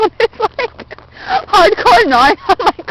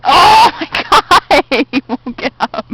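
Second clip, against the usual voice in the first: normal talking voice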